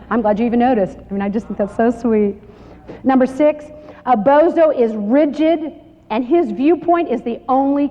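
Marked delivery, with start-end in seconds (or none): none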